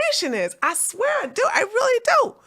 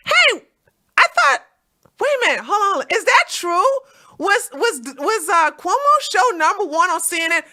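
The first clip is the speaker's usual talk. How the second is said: higher, louder disbelieving questions; incredulous; 9 semitones higher, 5 dB louder than usual. Real, not acted. Streamer reacting to Cuomo's ratings claim, loud and incredulous. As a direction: sound like you can't believe it.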